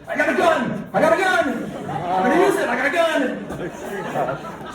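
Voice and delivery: high, loud hammed-up yelling of a startled dad; mock panicked shout. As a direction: bellow it like you just woke to a burglar. Dad startled, thinks burglars.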